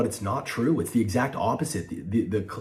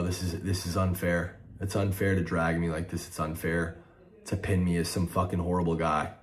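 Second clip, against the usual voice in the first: dejected; 5 semitones lower, 6 dB quieter than usual. Real, not acted. Hurt, deflated after outburst.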